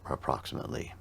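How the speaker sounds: normal talking voice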